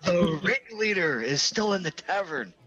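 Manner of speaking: high, stagey announcement; very loud. Acted. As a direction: say it pointedly to be overheard. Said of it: "I would have said very loudly".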